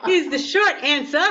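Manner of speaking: amused, talk through real laughter; laughing. Real, not acted. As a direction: let real laughter bubble through the answer. Laughing after blunt answer no.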